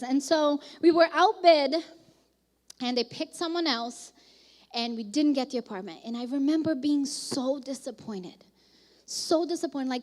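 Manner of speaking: usual speaking voice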